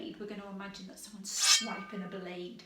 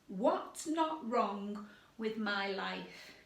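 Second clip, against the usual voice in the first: 1 slow demo sentence; much slower than usual. Shown as a demonstration. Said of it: "I'll do it first, I'll give an example".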